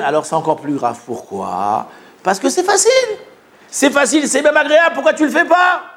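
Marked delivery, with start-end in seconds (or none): 2.2-5.8 high, insistent, incredulous questioning; exasperated reproach. Acted. Acts out reproach over easy deed. (reproche exaspéré)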